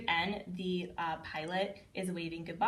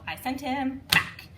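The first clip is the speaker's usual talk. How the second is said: emphatic storytelling; 6 semitones higher, 6 dB louder, slower than usual. Shown as a demonstration. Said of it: "we always go".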